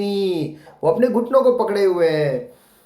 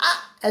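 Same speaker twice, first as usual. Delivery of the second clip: a higher, softer mock "aa" like a little monkey; monkey's cry; 8 semitones higher, 5 dB quieter than usual. Shown as a demonstration. Teacher showing kids how the monkey cried, higher softer squeak. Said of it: "ऐसे चिल्लाया".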